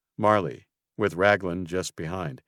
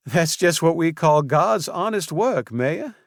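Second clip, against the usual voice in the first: cheerful Southern belle lilt; friendly Southern florist, loud and bright; southern accent; 10 semitones higher, 6 dB louder than usual. A narrator's reading. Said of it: "responded in an accent so southern".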